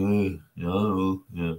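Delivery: imitated sheepish, hesitant answer; awkward non-answer, retold; embarrassed. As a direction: stumble over it like an embarrassed teenager. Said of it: "I was embarrassed".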